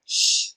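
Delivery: "sh" as a drawn-out hushing consonant; isolated SH sound. Shown as a demonstration. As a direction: say just the "sh" sound, no vowel. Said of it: "both have the consonant sound".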